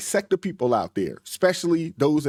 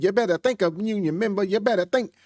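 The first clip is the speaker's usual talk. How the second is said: sarcastic put-on Southern accent, raised pitch; mock faux Southern accent; 5 semitones higher than usual. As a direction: imitate a politician putting on a Southern accent to a crowd. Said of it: "with this faux Southern accent".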